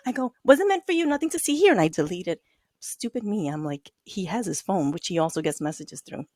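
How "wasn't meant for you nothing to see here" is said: high-pitched breezy cover-up; mock-innocent brush-off; feigned innocence. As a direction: act casual, pitched well up. Retold cover-up to coworker.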